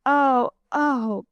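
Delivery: mildly shocked, drawn "oh, oh"; a small gasp of dismay; understated shock. A narrator's reading. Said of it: "gasped Bunny".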